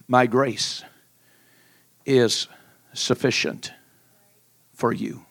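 hushed, slow and spaced words of God; voice of the Lord. Acted. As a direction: give each word weight with pauses.

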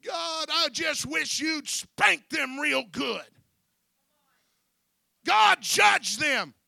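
loud, high, slow plea for punishment; hammed-up wrathful prayer, words drawn out; mock angry prayer. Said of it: "get mad at somebody in the church".

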